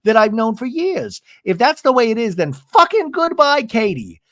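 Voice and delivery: high-pitched, acted-out indignation at a friend; retold indignant rant. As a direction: play up the outraged goodbye. Retold angry friend quarrel.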